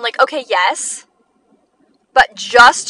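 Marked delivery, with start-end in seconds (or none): none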